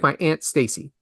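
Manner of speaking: Aunt said with the vowel of "cat"; aunt like ant. Shown as a demonstration. Host naming relative his way, Aunt like "ant". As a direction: say Aunt exactly like the insect ant. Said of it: "I like the word aunt".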